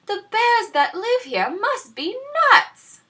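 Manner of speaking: put-on high, loud exasperated girl; exhausted girl groan. A narrator's reading. Tired Goldilocks complains to herself, high exasperated groan. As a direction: groan it out, fed up. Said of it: "groaned the exhausted girl".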